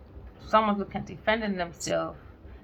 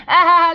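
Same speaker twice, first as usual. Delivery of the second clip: laughing gasp; 9 semitones higher, 15 dB louder than usual. Acted. Laughing at acted sob.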